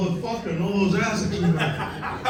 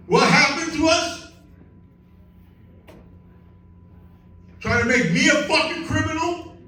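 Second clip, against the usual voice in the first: much higher, impassioned outrage; passionate rant; 11 semitones higher than usual. Real, not acted. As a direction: preach it like a fired-up protester. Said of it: "We got a lot of passion here".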